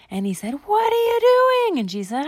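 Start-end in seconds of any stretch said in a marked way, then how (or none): 0.7-1.8 put-on very high, drawn-out kid shriek; hammed-up high, loud protest; shocked yell. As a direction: yell it slowly in outraged disbelief. Bar shocked at being sat on.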